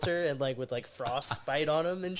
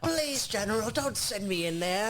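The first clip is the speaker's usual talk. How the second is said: pleading soldier; 8 semitones higher than usual. Retold or imitated. Mock pleading soldier quote.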